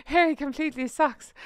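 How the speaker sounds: playful put-on Irish voice; Jamie Dornan. Playing the celebrity crush dissing Harry, mock Irish accent. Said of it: "I love my Irish geese".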